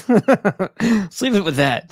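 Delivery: amused at himself, laughing as he wraps up. Real, not acted. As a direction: giggle out the sign-off. Laughs at own rambling sign-off.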